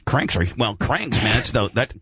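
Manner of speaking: ordinary talk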